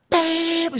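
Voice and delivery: high, drawn-out blare; imitated alarm blare. Smoke detector going off while babysitting, high sustained blare. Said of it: "the beep is so loud".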